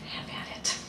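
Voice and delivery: whispered, bone-dry comeback; soft unvoiced aside; deadpan whisper. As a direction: breathe it out with a shrug. Deadpan reply to the boy.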